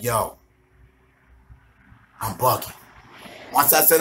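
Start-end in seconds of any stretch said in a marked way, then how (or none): 0.0-2.7 low, quiet panic. Retold or imitated. Realizing he is too high.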